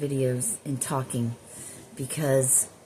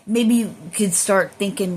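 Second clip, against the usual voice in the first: usual speaking voice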